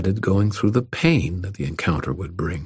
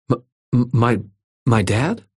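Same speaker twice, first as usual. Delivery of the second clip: put-on halting, higher-pitched boy's disbelief; stunned stammer; 5 semitones higher than usual. A narrator's reading. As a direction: stumble over the words in surprise. Startled boy stammers at news.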